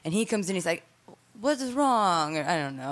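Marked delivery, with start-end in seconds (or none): 1.4-2.4 put-on higher-pitched, half-hearted Italian accent; attempted Italian accent. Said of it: "how to do an Italian accent".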